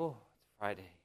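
soft, low sigh of relief; hushed end-of-week contentment, acted out; acted Friday relief. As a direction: savour it quietly, dropping low. Voicing end-of-week relief at freedom.

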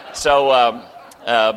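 ordinary talk

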